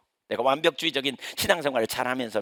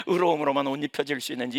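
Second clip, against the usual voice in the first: ordinary talk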